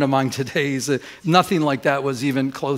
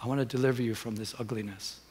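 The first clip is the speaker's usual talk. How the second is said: very soft, lower voice; still small voice; 3 semitones lower, 11 dB quieter than usual. Retold or imitated. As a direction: speak gently as God to the heart. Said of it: "in a still small voice".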